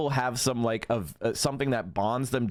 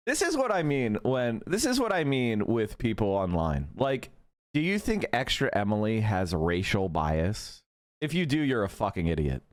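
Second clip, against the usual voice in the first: usual speaking voice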